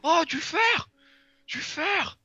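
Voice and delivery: high, loud shout of delight; excited. Real, not acted. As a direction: cry out thrilled at the find. Excited find of iron in mine.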